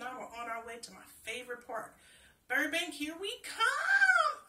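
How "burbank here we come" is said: much higher, loud, slow joyful cry; gleeful shout. A narrator's reading. Girl cheers on the way to the park, loud and gleeful. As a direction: call it out like the best day ever. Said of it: "I sang out in glee".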